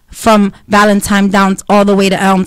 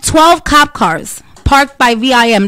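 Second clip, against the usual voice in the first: ordinary delivery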